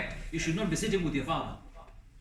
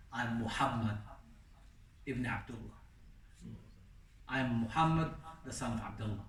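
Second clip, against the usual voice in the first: humble, soft reply; 4 semitones lower, 7 dB quieter than usual. Retold or imitated. Prophet's humble answer to rudeness.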